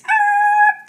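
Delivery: startled yell. Acted. Brakes hit, cry out.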